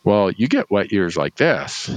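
ordinary delivery

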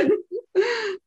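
squealing laugh near tears; high tearful laughter; high crying laugh. Real, not acted. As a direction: laugh till you nearly cry.

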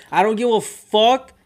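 ordinary delivery

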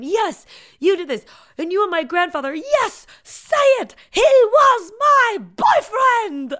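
high, loud mock confession building to a drawn-out cry; hammed-up melodramatic yelling; dramatic imitated outburst. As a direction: build to a slow, shouted climax. Quoting dramatic film confession scene.

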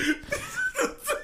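cracking up; high burst of laughter. Real, not acted. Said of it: "I'm trying to be serious".

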